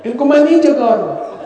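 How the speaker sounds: high, loud and quick retold yell; loud mock shout. Preacher imitating the companion shouting at dawn. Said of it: "زور زور سے کہا".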